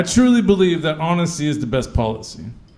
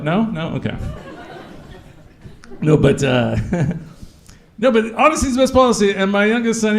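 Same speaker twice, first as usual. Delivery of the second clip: ordinary talk, nothing marked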